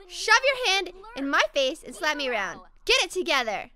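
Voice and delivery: mock-angry telling-off, loud and forceful; playful yelling. Gamer to the character who slapped her, mock-angry yelling. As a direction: yell at him like a play-scolding. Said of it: "I'm gonna yell at him".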